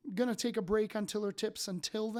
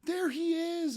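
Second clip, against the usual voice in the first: much higher and louder, starstruck gush; gushing fan; 9 semitones higher, 5 dB louder than usual. Retold or imitated. Stranger at the table gushing over the speaker on stage, high starstruck squeal. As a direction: squeal like you've spotted your idol. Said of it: "She's like".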